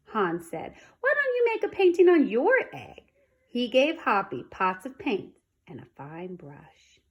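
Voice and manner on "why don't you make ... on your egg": bright eager offer. A narrator's reading. Hans offers a kind idea.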